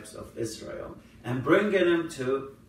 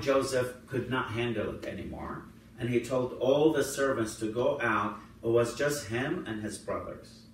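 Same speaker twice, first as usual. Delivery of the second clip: ordinary delivery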